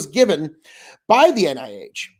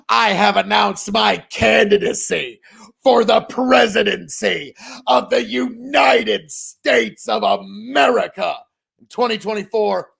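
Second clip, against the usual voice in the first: mock campaign speech. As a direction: announce the candidacy like a rally speaker.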